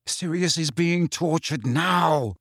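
usual speaking voice

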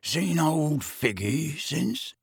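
slow, slurred-out rogue's drawl; mournful Mundungus. A narrator's reading. Mundungus asking Harry after old Figgy, mournful and slow. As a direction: drag the words out mournfully.

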